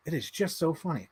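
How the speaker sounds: ordinary talk, nothing marked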